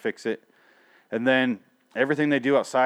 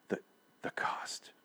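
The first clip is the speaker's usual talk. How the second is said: hushed, voiceless mock-solemn whisper; whispered mock drama; 13 dB quieter, slower than usual. Man mocking last episode's ending line, dramatic whisper. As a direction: whisper it like a portentous last word.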